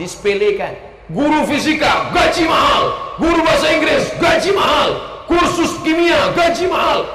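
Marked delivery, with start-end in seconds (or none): 1.1-7.1 shouting each pay line louder and higher; berseru keras. Real, not acted. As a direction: hammer the unfairness home, rising. Ceramah berapi-api soal gaji guru. (berseru lantang penuh semangat)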